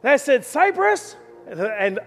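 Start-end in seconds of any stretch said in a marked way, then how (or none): 0.4-1.2 high, ringing call of a name. Retold or imitated. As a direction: call the boy across the park. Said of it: "something that you can yell".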